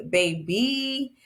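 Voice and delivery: usual speaking voice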